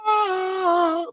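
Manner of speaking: high squeaky whistle-note squeal; tiny mock whistle-note cry.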